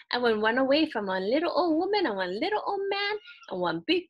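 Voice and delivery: high, loud sing-song taunt in pidgin; a gleeful little runaway rice ball; cheeky Musubi man. Acted. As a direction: tease them from far ahead, chanting. Musubi man taunts his chasers.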